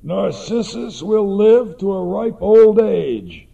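a hint of an old seer; Tiresias's prophecy. A narrator's reading. The mother recalls the seer's words, lightly voiced. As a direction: intone the prophecy gravely. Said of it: "remembered Tiresias's words".

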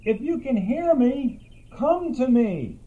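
calling out. Acted. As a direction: call to someone unseen in the woods. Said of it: "then called out even louder".

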